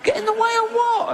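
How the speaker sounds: very high fast falsetto shout; squealed question. Retold or imitated. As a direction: shriek it in disbelief. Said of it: "the doctor went".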